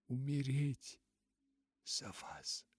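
broken repeat sinking to a whisper; sobbing, fading. A narrator's reading. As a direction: let the words die away into breath. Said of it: "всхлипнула она". (всхлип, затихающий до шёпота)

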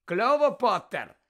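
high, loud, drawn-out jeer; loud taunting question. A narrator's reading. Malfoy to Harry as he comes closer, loud taunt. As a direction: call it out slowly and loudly. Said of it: "голосно спитав Мелфой".

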